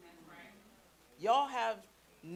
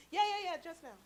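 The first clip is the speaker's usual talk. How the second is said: imitated distracted nephew; 11 semitones higher than usual. Nephew on his tablet putting off his auntie, high kid voice. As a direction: mimic a kid putting off an aunt. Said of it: "They love their tablets".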